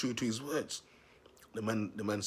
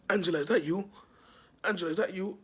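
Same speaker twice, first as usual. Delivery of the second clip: high, loud teasing singsong call; 9 semitones higher, 6 dB louder than usual. Retold or imitated. Teasing the stepdad from the crowd with a high, loud call. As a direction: call out at the stepdad to wind him up. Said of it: "I'm always like".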